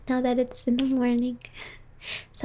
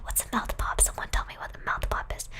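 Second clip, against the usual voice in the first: whispering; 5 dB quieter than usual. Real, not acted. Whispering ASMR stream.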